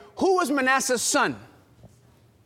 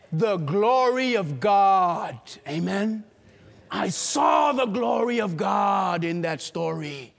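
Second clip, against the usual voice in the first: usual speaking voice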